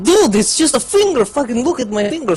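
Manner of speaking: high exasperated whine; exasperated plea. Retold or imitated. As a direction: plead with the friends to look. Pleading friends to look.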